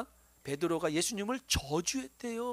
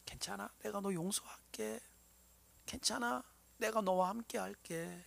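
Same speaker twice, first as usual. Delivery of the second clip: much softer, gentle forgiving voice; tender reassurance; 13 dB quieter than usual. Acted. Jesus gently forgiving Peter, soft and tender. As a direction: comfort him quietly, like soothing a child.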